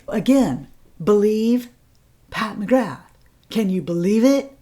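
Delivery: ordinary talk, nothing marked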